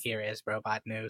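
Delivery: quiet flat robot voice. Pretend. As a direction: intone it like a machine. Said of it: "I'll make you sound like a robot".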